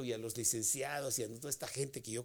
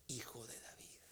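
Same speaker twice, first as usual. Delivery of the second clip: voiceless, much softer whisper of the cry; 4 semitones lower, 16 dB quieter than usual. Acted. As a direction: whisper the plea instead of shouting it. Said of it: "Y grita".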